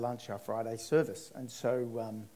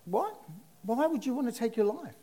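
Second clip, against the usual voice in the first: jumps much higher in sudden shock; shocked disbelief; 11 semitones higher than usual. Retold or imitated. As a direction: blurt it out, stunned. Hearing man tried to end life.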